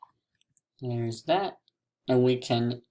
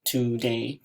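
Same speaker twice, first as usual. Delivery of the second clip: TODAY in two even beats, to, day; TODAY drawn out as to-day; split into syllables. Shown as a demonstration. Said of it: "line in between the two syllables".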